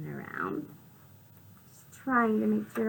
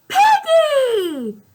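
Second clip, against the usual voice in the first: very high, loud call of the name; joyful shout; 19 dB louder than usual. Acted. Gerald greeting Piggy, loud happy shout. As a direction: call out to a friend with joy. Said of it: "and says".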